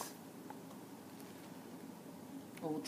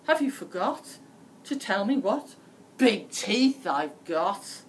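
louder, slowing on the teeth; loud insistent wolf; 9 dB louder than usual. A narrator's reading. Wolf insists Red ask about his teeth, loudly. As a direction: demand it, dragging out the last words. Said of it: "cried Wolf".

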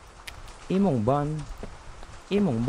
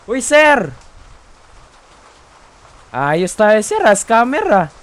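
loud call after someone; calling out loudly; 7 semitones higher, 12 dB louder, slower than usual. Pretend. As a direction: yell after him down the street. Calling after non-paying customer.

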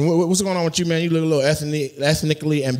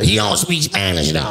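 mocking copy of his odd voice; imitating audience member. Comic throwing the Spanish line back at him, mocking imitation. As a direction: parrot the man's strange delivery. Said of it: "Why you sound like that?".

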